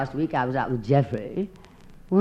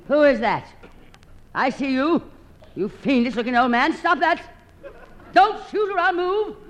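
mock fright; 12 semitones higher than usual. Woman startled by her mirror, mock frightened cry. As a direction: cry out at an intruder, hammed-up.

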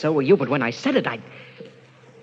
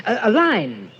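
agitated; 9 semitones higher, 6 dB louder than usual. Acted. Husband building to frantic dread.

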